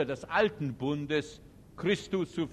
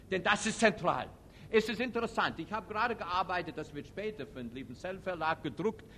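ordinary talk, nothing marked

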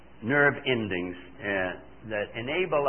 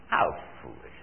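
a breathy, hushed repeat of the scoff; whispered scorn; 10 semitones lower, 4 dB quieter than usual. Acted. Preacher repeating his scorn to the congregation, whispered. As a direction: whisper it, shaking your head at the folly.

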